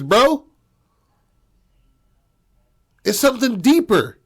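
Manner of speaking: exasperated rebuke building in pitch; heated confrontation. Acted. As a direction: lay into him, pitch climbing as it builds. Acted father confronting his son.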